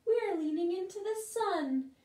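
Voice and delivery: high gentle flower voice; lightly voiced flowers. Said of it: "say the flowers".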